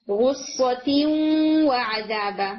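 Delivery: غصۃ و عذابا very slow, the tanween nasalised into و; nasal ghunna merge. Shown as a demonstration. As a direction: hum the noon through the nose into و, drawn out. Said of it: "آپ غنہ کریں گے".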